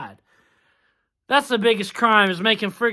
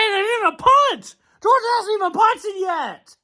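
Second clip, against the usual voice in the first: exasperated outburst; 10 semitones higher, 3 dB louder than usual. Real, not acted. Fan ranting at his team's collapse.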